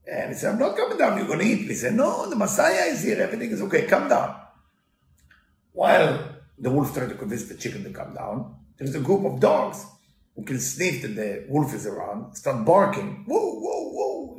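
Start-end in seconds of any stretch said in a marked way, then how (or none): 0.5-1.7 high, refusing chicken; scared chicken. Retold or imitated. Chicken refuses to come down to wolf, high. 1.9-4.4 high, sweet-talking wolf; coaxing wolf. Retold or imitated. Wolf reassures chicken that Messiah is here, high. 13.3-14.4 high woofing bark; dog barking imitation. Preacher imitates dogs barking at the wolf, high. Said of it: "you know, like dog".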